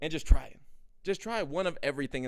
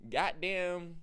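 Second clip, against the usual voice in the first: ordinary talk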